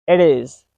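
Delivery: it is run together as "ih-diz"; linked flap T. Shown as a demonstration. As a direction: link it is with a tapped T. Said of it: "happens in between two words".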